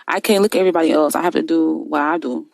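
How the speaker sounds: ordinary talk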